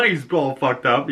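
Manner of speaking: ordinary delivery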